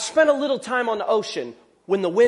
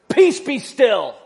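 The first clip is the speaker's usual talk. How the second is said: "Peace be still" barked loud and slow, each word hit hard; a grumpy, forceful order to the storm; ticked-off command; 3 semitones higher, 6 dB louder, slower than usual. Retold or imitated. Jesus, irritated, orders the sea, mock angry. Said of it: "a little ticked off".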